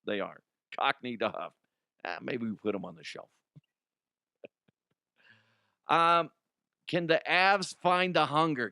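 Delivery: ordinary talk, nothing marked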